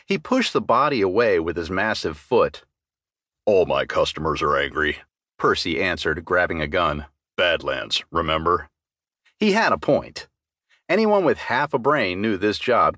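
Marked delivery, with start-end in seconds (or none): 3.5-5.0 deep, gruff troll barkeep; low and weary, a bar-owning troll; troll barman, lower and unbothered; troll bartender. Acted. Troll bartender Percy voiced. 7.4-8.7 deep, gruff troll barkeep; low and weary, a bar-owning troll; troll barman, lower and unbothered; troll bartender. Acted. Troll bartender Percy voiced.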